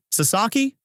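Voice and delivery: high, rising call of a name; calling again. A narrator's reading. Takashi calls to a lost Sasaki, calling again. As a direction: call out questioningly, pitched well up. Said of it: "Takashi calls again".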